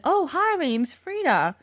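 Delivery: chirpy mock hello from a story character; friendly introduction. Introducing herself in a retold story.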